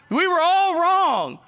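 high, loud, slow cry of all wrong; big stretched proclamation; loud first take. Real, not acted. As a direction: shout it to the whole room. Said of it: "Listen to what I'm about to tell you".

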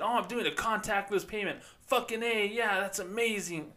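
cheesy amazed bro voice; mock bro talk. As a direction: gush like a fake ad. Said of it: "Who talks like that?".

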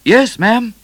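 high, loud answer across a room; calling back. A narrator's reading. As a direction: call back promptly to the Queen. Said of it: "called Midnight from the other end".

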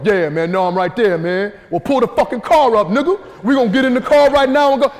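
loud, high street tough barking orders; tough street guy. Retold or imitated. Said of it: "you're expecting him to go".

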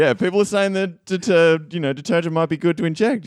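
loosely imitated presidential patter; Donald Trump. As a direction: hedge it like a politician passing on rumours. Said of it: "Donald Trump impersonation".

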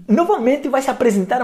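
normal talking voice

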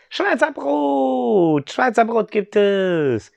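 put-on high, slow children's chant; children shout the answer at once, high and drawn out; kids blurting. Joschirsch and Boba answer their father: high, slow eager child voices. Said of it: "wie aus der Pistole geschossen".

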